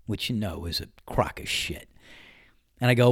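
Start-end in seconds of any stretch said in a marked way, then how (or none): 0.1-1.9 soft, under-the-breath dig; muttered aside. Real, not acted. Scoffing at the man's excuse, under his breath.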